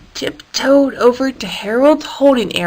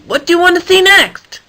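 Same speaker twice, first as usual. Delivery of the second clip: eager question; 9 semitones higher, 4 dB louder than usual. Acted. David asks again, raised.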